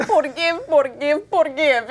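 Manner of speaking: put-on high Spanish-accented old man, repeating; playful accented old-man impression; broken English. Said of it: "In his broken English".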